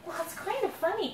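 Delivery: plain reading voice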